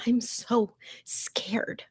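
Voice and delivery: slow, breathy, terrified whisper; a frightened victim in the closet whispering; scared whisper. Acted. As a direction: whisper it like you're hiding from something. Frightened narrator hiding from creature.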